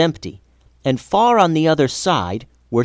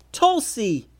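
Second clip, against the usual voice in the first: yelled name; 10 semitones higher, 3 dB quieter than usual. A narrator's reading. Mia yelling after her runaway friend, high held-back yell. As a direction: call out after a friend running off. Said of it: "yelled Mia".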